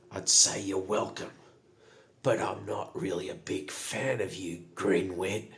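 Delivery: lightly voiced unimpressed goblin; Saza's cool reply. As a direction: let the dislike show, measured and dry. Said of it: "And Saza turns to him and goes".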